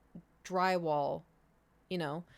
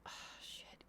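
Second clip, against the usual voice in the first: imitating a hushed, breath-only curse; whispered dismay; 19 dB quieter than usual. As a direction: whisper it to yourself in mild panic. Retelling dread at messy apartment.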